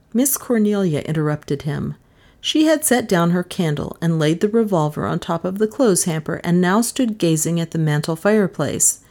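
ordinary delivery, nothing marked

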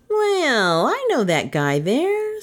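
high, drawn-out barfly voice; high-pitched, swooping chummy voice. A narrator's reading. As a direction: pester them as the over-friendly regular. Chummy barfly Bert accosts newcomers.

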